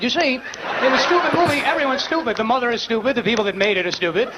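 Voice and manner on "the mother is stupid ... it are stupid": normal talking voice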